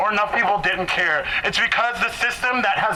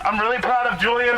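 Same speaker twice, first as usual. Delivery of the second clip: normal talking voice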